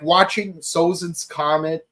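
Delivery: normal talking voice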